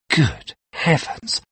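slow, high, appalled; horrified exclamation. A narrator's reading. Painter stares at the hideous portrait, slowly horrified. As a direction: breathe it out slowly in horror. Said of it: "An exclamation of horror came from the painter's lips".

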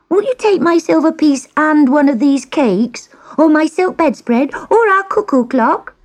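put-on high-pitched, eager girl; begging. A narrator's reading. Girl bargains with the witch, high begging. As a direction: plead high, piling up the offers. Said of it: "begged the girl".

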